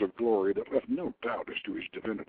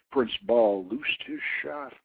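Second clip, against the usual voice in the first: ordinary delivery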